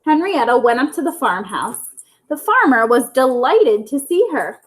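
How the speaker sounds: ordinary delivery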